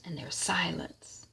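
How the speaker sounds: whisper. Shown as a demonstration. Hush as Candace arrives.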